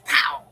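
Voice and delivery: sudden "pow" sound effect; short, explosive mouth noise; whip-crack noise. Retold or imitated.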